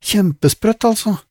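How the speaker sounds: put-on very high girlish voice; girl's voice. Lizzie marvels to her friends, very high girlish. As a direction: squeak it out like a young girl.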